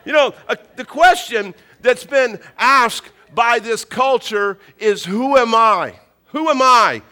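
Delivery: loud, high, emphatic pulpit voice; impassioned sermon, punching key words; forceful preaching. Real, not acted. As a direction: drive the point home to the congregation. Preaches the main point forcefully.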